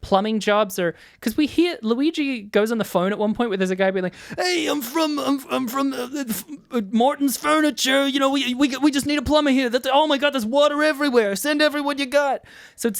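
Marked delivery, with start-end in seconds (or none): 4.3-12.4 higher, frantic New York caller voice; panicky caller. Retold or imitated. Host imitates a panicked furniture-store caller on the phone. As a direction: play a flustered guy begging for a plumber.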